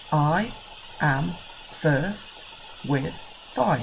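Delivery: "I", "am", "first", "with", "five" each said separately with pauses between them; a teacher clapping syllables for children; counting the beats. Shown as a demonstration. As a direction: tap out "I am first with five" one word at a time. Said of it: "It's got five syllables".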